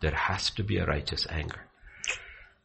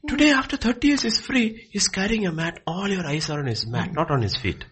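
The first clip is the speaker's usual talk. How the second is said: high, loud indignant preaching; fiery preaching; 13 semitones higher, 4 dB louder than usual. Real, not acted. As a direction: hammer the hypocrisy home.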